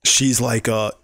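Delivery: normal talking voice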